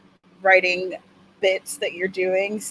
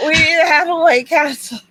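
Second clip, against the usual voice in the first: excited shout; 7 semitones higher, 7 dB louder than usual. Real, not acted.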